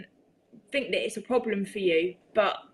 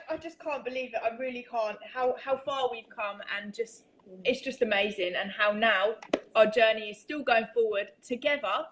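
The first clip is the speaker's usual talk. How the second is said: normal talking voice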